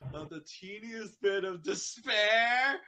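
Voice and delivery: slow, high, loud disbelief; incredulous question. Acted. Spike quizzing Pinky, loud slow disbelief.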